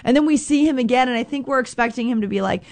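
ordinary delivery

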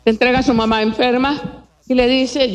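normal talking voice

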